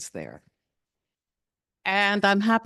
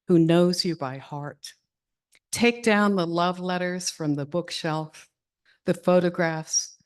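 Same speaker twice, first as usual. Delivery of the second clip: poetry reading; 5 dB louder than usual. Acted. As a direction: read each phrase with pauses. Reads Love After Love aloud.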